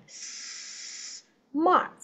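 "Smart" with a long breathy s before mart; drawn-out s. Shown as a demonstration. Teacher drilling the s sound for the student. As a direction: stretch the hiss of the s in "Smart". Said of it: "âm sờ nè".

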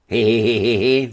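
high, loud acted giggle; giggling laugh. A narrator's reading. Fjodor joins in the joke, giggling loudly. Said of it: "zasmál se též Fjodor".

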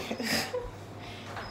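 high, breathy strained whine; high strained whimper. Real, not acted. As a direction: whimper while holding it. Strained cry while holding it.

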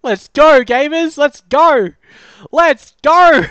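high, loud cheering; excited shout. Real, not acted. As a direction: cheer it out at full voice. Celebrating the win.

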